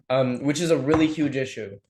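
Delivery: ordinary talk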